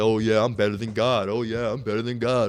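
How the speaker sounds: sneering mock boast in a put-on voice; mocking proud boast. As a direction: lightly mimic an arrogant boaster. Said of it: "You wanna go and be pride and say".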